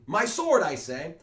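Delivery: put-on high, loud old man's demand; old Capulet yelling for his sword; insistent shout. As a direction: shout it over her, insisting.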